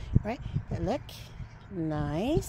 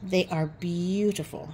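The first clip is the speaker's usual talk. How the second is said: ordinary delivery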